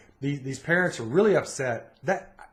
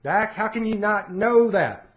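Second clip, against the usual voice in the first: a higher, louder disbelieving rant; exasperated dad, raised pitch; 8 semitones higher, 5 dB louder than usual. Retold or imitated. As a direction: mimic dad's loud incredulous scolding. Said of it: "and he's like".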